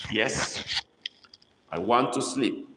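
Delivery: usual speaking voice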